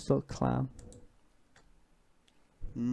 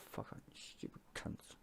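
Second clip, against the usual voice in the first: whispered curse; 23 dB quieter than usual. Real, not acted. Streamer cursing PR advisers under his breath, whispered. Muttered insult.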